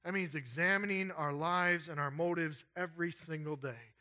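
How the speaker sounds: usual speaking voice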